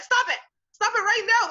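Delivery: put-on a parent snapping at a crying boy; mock parent scolding. Parents scolding a son for crying, in a high, loud scold. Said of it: "they're like".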